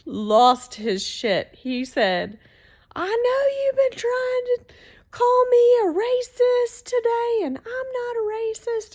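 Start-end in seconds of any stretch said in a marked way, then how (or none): 2.9-8.9 much higher, loud, furious yelling; angry outburst. Retold or imitated. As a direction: re-enact him losing his temper at full pitch. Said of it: "kept getting louder and louder and madder".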